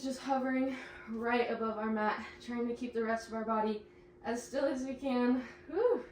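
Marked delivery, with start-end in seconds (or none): 5.7-6.0 a real exhaled whew, pitch jumping high; winded whew after the hover hold; breathy whew. Real, not acted.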